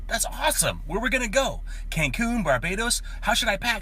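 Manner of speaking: high, excited acted voice; thrilled husband. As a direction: fire off questions in giddy delight. Husband thrilled by lottery news.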